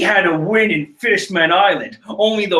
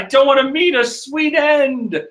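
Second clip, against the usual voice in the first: mock Brook voice; 7 semitones higher than usual. I need a good voice for Brook.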